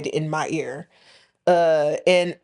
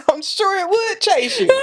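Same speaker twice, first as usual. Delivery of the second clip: laughing; 12 semitones higher than usual. Real, not acted. Friends laughing about kangaroos.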